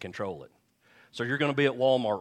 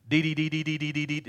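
put-on high, sing-song baby babble; toddler babble; 5 semitones higher, faster than usual. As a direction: imitate a toddler babbling one syllable. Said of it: "this is how he would do it".

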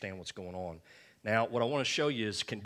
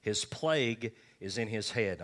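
slow, solemn Bible reading; scripture reading; 4 dB louder than usual. Acted. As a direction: read the verse out slowly and gravely. Reads Leviticus aloud from King James.

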